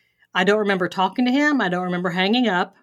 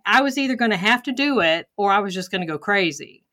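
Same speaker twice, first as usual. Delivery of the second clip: normal talking voice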